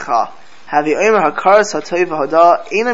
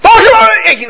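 sudden high, loud shout; mock davening scream; 8 dB louder than usual. Rabbi imitating Stolin chassidim screaming in prayer. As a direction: belt it like the whole shul shakes. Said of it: "they scream in the davening".

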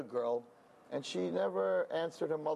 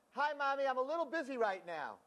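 sweet but harried; 8 semitones higher than usual. Retold or imitated. Harried wife on the phone.

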